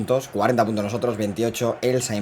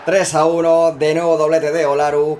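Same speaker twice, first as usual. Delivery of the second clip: excited goal call; 5 semitones higher, 7 dB louder than usual. Real, not acted. Calls the third goal.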